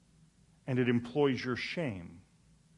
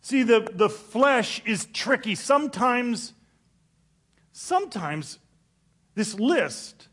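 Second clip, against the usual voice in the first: preaching; 8 semitones higher, 9 dB louder, slower than usual. Acted. Preaching at full force.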